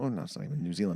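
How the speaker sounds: usual speaking voice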